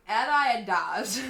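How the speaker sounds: Adidas as ad-EYE-uh-dahs, slow and laughing; mock variant of Adidas, laughing; silly Adidas. Shown as a demonstration. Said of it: "top five ways to say Adidas".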